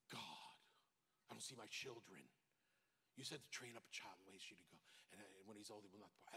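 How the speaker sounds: soft, low pleading to God; quiet retold prayer. Pastor recalling his prayer, soft and low. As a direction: murmur the prayer under his breath.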